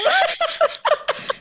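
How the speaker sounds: squeaky high laughing what; amazed squeal. Retold or imitated. Retelling amazed reaction.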